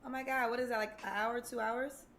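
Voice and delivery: usual speaking voice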